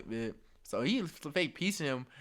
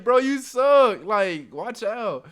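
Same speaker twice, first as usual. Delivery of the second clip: much louder, higher and drawn out slow; loud taunt; 6 semitones higher, 11 dB louder, much slower than usual. Retold or imitated. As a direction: lean into the insult, slow and loud. Trash talk repeated harder.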